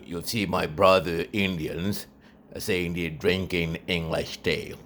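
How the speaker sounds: ordinary delivery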